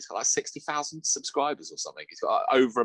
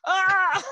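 high, loud shocked squeal; 7 dB louder than usual. Retold or imitated. Girl hit with a filthy chat-up line squeals in shock. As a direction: squeal in scandalised delight.

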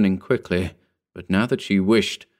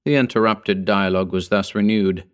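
ordinary delivery, nothing marked

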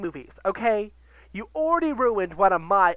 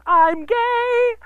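mock flamboyant dancer; 8 semitones higher, 4 dB louder, slower than usual. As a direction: ham up a flamboyant dancer shouting from a car roof. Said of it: "homo jumping on a car".

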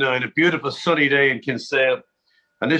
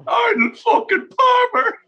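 much higher, slow, louder cry of disbelief; starstruck shout; 4 dB louder, slower than usual. Retold or imitated. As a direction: yell a legend's name in awe. Drunk fan recognizing Palmer.

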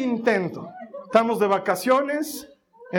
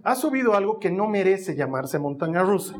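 ordinary talk, nothing marked

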